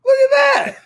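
amazed laugh at his own work; laughing amazement. Retold or imitated. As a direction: marvel at it through laughter. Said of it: "I was like".

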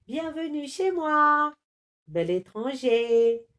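high, slow, cooing yeti lady; lady yeti cooing at a handsome stranger. A narrator's reading. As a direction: flirt sweetly with the stranger. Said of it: "roucoula demoiselle Yeti".

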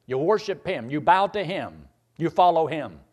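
ordinary talk

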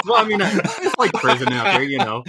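talking through high-pitched laughter; real laughing. Real, not acted. Storyteller joking to the co-hosts about deployment, laughing. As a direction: squeeze the words out between laughs.

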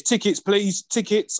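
high, carrying call of a ticket inspector. Retold or imitated. Ticket inspector walking the train, calling out for tickets. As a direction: sing out for tickets down the carriage.

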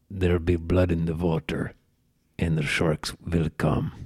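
put-on low Russian accent, measured and heavy; deep Russian-accented menace with pauses; Mickey Rourke. Host quoting a film villain, low Russian accent. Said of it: "the best Russian".

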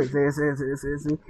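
drowsy, quiet yes yes; half-asleep murmur; sleepy mumble. Retold or imitated. Diego half asleep obeying.

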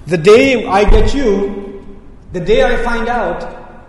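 slow, drawn-out mock threat; warning. Shown as a demonstration. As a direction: warn them slowly, stretching the words. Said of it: "It is used when you warn someone".

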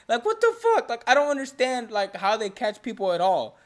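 high, loud exasperated disbelief; baffled outburst. Real, not acted. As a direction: rant as if it makes no sense. Baffled rant about checkpoint.

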